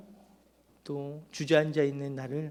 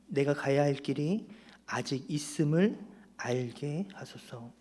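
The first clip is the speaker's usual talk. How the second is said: slow, deliberate prayer line for the congregation; slow prayer topic. Shown as a demonstration. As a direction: lay out each word slowly as a prayer. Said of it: "다시 한번 말씀드립니다". (천천히 또박또박 기도 제목을 읽듯)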